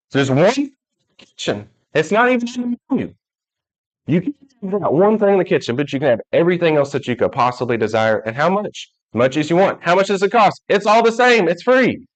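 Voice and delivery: high, pushing sermon voice; excited preaching. Acted. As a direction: drive the point home with excitement. Preaching climax on free food.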